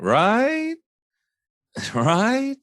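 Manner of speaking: Right? rising high, with a laugh; upswing tail. Shown as a demonstration. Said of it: "a little upswing".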